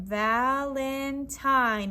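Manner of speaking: Valentine stretched into VAL, IN, TINE, very slowly; syllable by syllable. Shown as a demonstration. Teacher scooping syllables with class, Valentine slowly stretched out. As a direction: draw out each syllable of Valentine in turn. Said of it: "Let's say the syllables as we scooped them".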